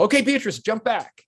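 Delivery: high-pitched called-out cue; imitation of a crew member's high call; calling directions. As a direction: cue an actress from across the set.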